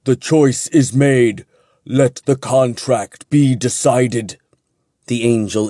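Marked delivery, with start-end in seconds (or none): 0.0-4.4 slow, solemn pronouncement; ominous and formal. A narrator's reading. Angel sealing the contract, ominous formal intoning. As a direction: intone it like a sentence passed. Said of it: "his voice ominous and formal".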